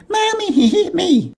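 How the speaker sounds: very high tattling falsetto; little sister screaming. Retold or imitated. Sister tells mom on brother, little sister screaming. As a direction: squeal it like an imitated little girl. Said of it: "and then she'd scream".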